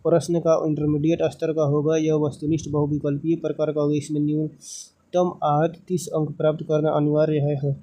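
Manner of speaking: ordinary talk, nothing marked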